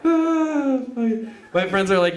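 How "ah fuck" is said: high breathless laugh. Real, not acted. Laughing exclamation.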